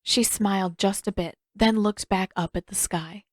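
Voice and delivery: usual speaking voice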